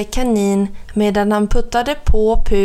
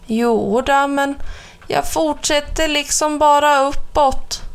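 somewhat worried; 5 semitones higher than usual. A narrator's reading. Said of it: "svarade han något oroad".

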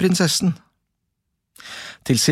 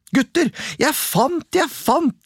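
high, eager sing-song call; 13 semitones higher, slower than usual. A narrator's reading. Askeladden calling to his brothers, high excited cry. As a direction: crow about the find to the boys. Boy crowing over his find.

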